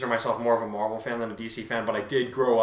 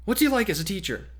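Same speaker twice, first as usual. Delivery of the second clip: higher, eager questioning; eager reporter; 8 semitones higher than usual. Acted. Reporter pressing students about All Might, eager higher voice. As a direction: lean in hungry for the story. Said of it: "the lady practically squealed with glee".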